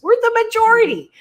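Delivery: majority punched high and loud; emphatic exclamation. Real, not acted. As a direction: throw "majority" out with a big leap up, loud. Stressing point to listener.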